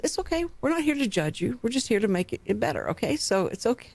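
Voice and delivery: imitating a gentle, non-judging doctor; soft, kindly mock doctor voice; sweet, kind reassurance. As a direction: soothe the patient, starting high and soft. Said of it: "They're very sweet, very kind".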